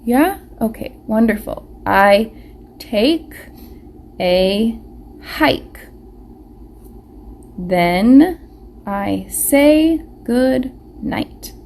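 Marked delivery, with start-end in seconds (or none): 1.8-11.5 slow word-by-word reading aloud; slow read-along. Shown as a demonstration. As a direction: sound out each word slowly with the class. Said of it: "reading together".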